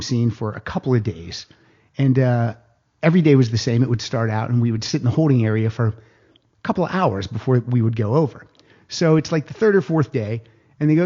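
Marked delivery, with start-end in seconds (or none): none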